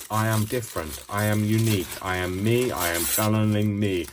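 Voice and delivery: loud, hammed-up French accent; proud declaration in a mock French accent; inner French person. Crafter declaring she is unique, comic French accent. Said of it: "channeling the inner French person".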